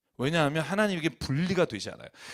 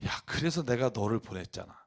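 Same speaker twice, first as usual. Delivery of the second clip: lowered, softer voice of God; God answering; 5 semitones lower, 5 dB quieter than usual. Retold or imitated. God replies to a complaining helper, low and soft divine voice. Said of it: "하나님이 응답 오셨다고".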